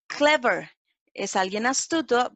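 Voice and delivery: ordinary talk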